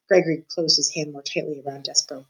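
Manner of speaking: ordinary delivery, nothing marked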